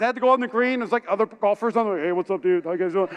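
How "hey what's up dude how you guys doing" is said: lower-pitched sheepish hello; embarrassed greeting. Retold or imitated. Golfer to players on the wrong green, embarrassed. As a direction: play it casual to hide embarrassment. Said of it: "so I was embarrassed".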